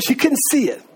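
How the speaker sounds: ordinary delivery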